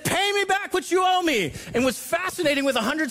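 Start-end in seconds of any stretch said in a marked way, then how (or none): 0.0-1.5 high, hammed-up demand; a touch of a furious creditor; mock choking demand. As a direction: squeeze the words out like you're choking him. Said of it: "chokes out the servant and says".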